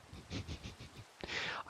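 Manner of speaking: long voiceless chuckle; whispered laugh. Real, not acted. Player recalling the house catching fire, whispered laugh.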